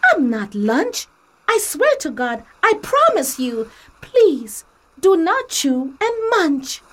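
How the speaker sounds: tiny straw begging not to be eaten; Emmy pleads with a hungry turtle, panicked; Emmy the straw, alarmed. Acted. Straw shouts at biting turtle.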